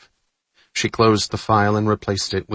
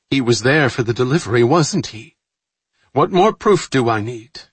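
louder, faster, the suspicion taking hold; rising suspicion; 4 semitones higher, 5 dB louder than usual. A narrator's reading. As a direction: press the point, quickly and loudly.

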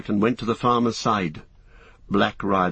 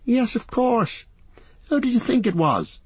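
put-on young hobbit voice; 14 semitones higher than usual. Merry voiced in story.

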